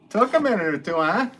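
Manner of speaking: high, loud burst of amused teasing; high-pitched, delighted ribbing. Real, not acted. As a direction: crow it loudly, about to break into laughter. Teasing the slow joke, then laughing.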